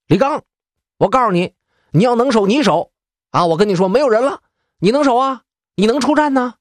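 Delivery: high, pushy taunting voice; cheeky high-pitched goading; mock rascally whine. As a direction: needle him like a street brawler, pitched well up. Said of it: "就开始耍赖了".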